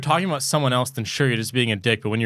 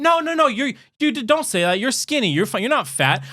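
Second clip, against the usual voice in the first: higher, sing-song mock reassurance; mock reassuring friend; 9 semitones higher than usual. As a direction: parody the soothing friend, pitched up. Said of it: "I'm not saying".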